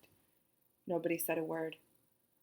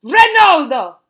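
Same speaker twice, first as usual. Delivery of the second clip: very loud, higher grand bellow; 9 semitones higher, 17 dB louder, slower than usual. A narrator's reading. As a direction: bellow the name across the ballroom. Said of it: "bellowed Missus Walouche".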